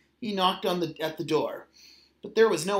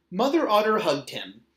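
plain reading voice

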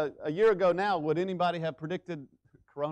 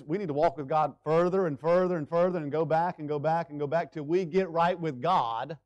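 ordinary delivery

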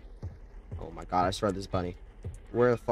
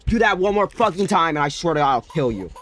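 angry threat; 7 semitones higher, 9 dB louder than usual. Real, not acted. Threatening baby again.

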